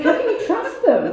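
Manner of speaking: high, loud, laughing disbelief; incredulous. Retold or imitated. As a mother refusing the tape, high laughing disbelief. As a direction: sound aghast at the idea. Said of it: "I'd be like".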